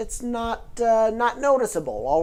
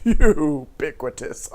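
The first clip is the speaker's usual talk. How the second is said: ubiquitous drawn out loud and slow through a laugh; laughing loud take; 3 semitones lower, 6 dB louder, slower than usual. Shown as a demonstration. Showing a word that is fun to say, laughing loudly. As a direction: belt out ubiquitous slowly, laughing. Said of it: "fun to say".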